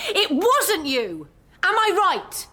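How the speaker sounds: rising, pressing, slow and insistent; triumphant accusation. Acted. Confronting professor with exposed lies.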